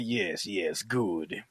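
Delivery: low, soft villain relish; imitating a scheming villain. Bishop watches from the rafters, scheming villain. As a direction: drop low and savour each word.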